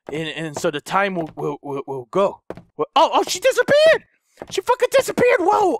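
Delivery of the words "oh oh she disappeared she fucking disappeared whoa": much higher, shocked exclamation; surprised as the monster vanishes; startled shout. Real, not acted. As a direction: caught off guard, cry it out.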